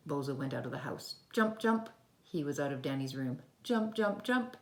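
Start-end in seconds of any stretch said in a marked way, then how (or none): 1.3-4.6 higher, louder springy calls; storyteller voicing a hopping frog; bouncy jumps. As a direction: bounce each jump playfully.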